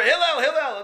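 high-pitched mock calling; screaming. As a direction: call out the name pestering him. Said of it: "he's screaming".

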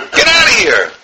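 long, falling dismissal; dismissive. Acted. Preacher rejecting a bad argument, dismissive. Acted dismissal of invalid sources.

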